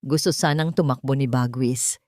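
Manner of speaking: ordinary delivery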